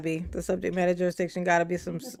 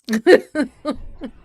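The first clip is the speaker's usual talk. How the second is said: loud burst of laughter; 6 semitones higher, 11 dB louder than usual. Real, not acted. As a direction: laugh out hard. Laughs at own joke.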